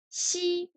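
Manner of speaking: chi held high and level; first tone. Shown as a demonstration. French learner's lesson, showing chi in first tone. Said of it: "Chi premier ton".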